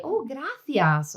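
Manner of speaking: high, drawn-out delighted exclamation; encouraging teacher. Acted. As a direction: greet a child's word with slow, lifted delight. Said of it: "if the teacher was to say".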